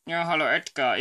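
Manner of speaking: ordinary delivery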